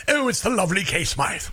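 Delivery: light mock-British introduction; put-on British greeting; slightly British. Shown as a demonstration. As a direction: introduce the guest in a playful British accent. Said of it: "you always sound slightly British".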